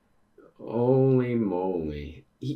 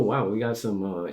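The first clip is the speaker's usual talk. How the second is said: ordinary delivery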